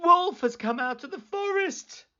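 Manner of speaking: high, urgent false alarm; high mock-panicked cry. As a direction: call it out as if panicked. Boy raising false alarm.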